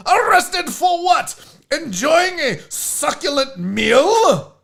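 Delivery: high, loud, drawn-out English indignation; theatrical English gent protesting his arrest; English accent. Acted. Dapper cat as the arrested guy, outraged English accent. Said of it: "Your English accent is better".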